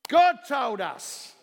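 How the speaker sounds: high, slow, booming declaration; loud proclamation. Real, not acted. Preacher giving the church its one answer, proclaiming loudly.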